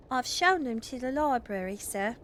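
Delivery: Scouser maid. Acted. Parlour maid reports visitors to the master, in Scouse. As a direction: put on a maid's Scouse accent. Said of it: "always has, like, a Scouser accent".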